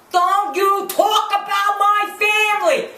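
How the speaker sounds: high, drawn-out shriek imitating her; mock crazed yell. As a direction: yell it slowly like someone unhinged. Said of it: "Like a friggin' nut!".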